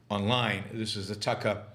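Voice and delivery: ordinary talk, nothing marked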